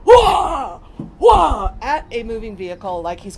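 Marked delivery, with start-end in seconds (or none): 0.1-1.7 high, loud yelled wah; father's wild shouted wah; father yelling wah. Retold or imitated. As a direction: bellow wah at the moving car. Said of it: "and yells".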